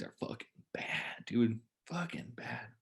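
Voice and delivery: whispered in disgust. Real, not acted. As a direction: hiss it like a fan who can't bear to watch. Disgusted about the Vikings.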